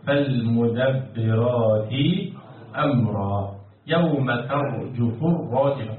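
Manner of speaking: low, slow and measured chant; Quran recitation. Shown as a demonstration. Teacher quoting God's word to the class, solemn slow recitation. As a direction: intone the verses with long-held vowels. قال تعالى.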